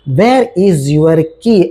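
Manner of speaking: very slow, clear English for learners; slow model sentence. Shown as a demonstration. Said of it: "example देख लेते हैं".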